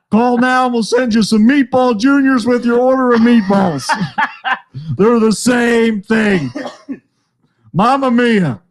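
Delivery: jokey call-now announcer; infomercial pitchman. Acted. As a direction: pitch it like a hammed-up TV ad. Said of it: "If you needed this infomercial".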